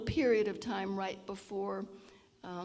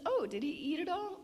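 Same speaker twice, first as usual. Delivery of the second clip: higher, lightly surprised mother; a touch of a mother's surprise; a mother asking, mildly surprised; 8 semitones higher than usual. Retold or imitated. Mother surprised at ice cream.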